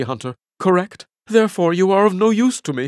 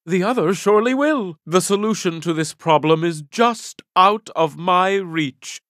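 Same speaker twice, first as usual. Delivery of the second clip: normal talking voice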